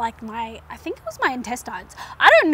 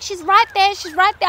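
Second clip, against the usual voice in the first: higher, louder eager chorus; mock-excited room of helpers; excited urging; 7 semitones higher, 9 dB louder than usual. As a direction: cheer her on, she's nearly out. Everyone urging during the birth.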